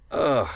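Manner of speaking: high, loud disgusted groan; a fed-up ugh at scripts. Real, not acted. Telling friends how scripts feel, a loud disgusted ugh. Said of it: "scripts for me are like".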